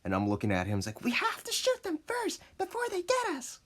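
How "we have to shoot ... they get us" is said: put-on high, tense little-boy voice; urgent kid. Boy to Tony while hiding from the players, urgent high voice. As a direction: urge a friend in mock desperation, pitched way up.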